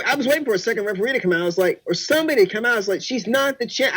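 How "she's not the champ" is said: ordinary talk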